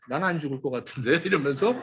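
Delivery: fast, hushed joking denial, then a laugh; laughing mock denial. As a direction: tease the congregation's denial, chuckling. Mocking congregation's denial, laughing.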